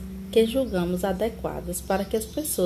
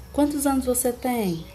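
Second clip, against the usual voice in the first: "Quantos anos você tem" raised high, then falling; question intonation; 4 semitones higher than usual. Shown as a demonstration. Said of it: "A gente tem essa entonação". ("Quantos anos você tem" com entonação de pergunta)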